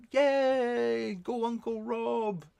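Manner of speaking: high, loud cheer, then a slow "Go Uncle Rob"; excited cheer. Real, not acted. Streamer cheering an uncle who just joined chat, excited high cheer. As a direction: cheer him on like a fan in the stands.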